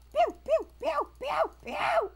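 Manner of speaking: loud, high mimicked zaps; frantic laser pews. Player firing wildly in a game, loud high pews. Imitating rapid gun shots.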